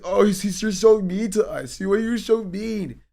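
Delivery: high, loud whiny sobbing mock; mock crybaby whine. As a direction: whimper it like a wounded baby. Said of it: "It's like".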